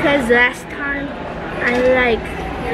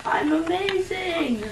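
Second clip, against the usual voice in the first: hoarse voice; 5 semitones higher, 6 dB quieter, slower than usual. Real, not acted. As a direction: speak through a hoarse throat. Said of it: "This child has a really bad hoarse voice".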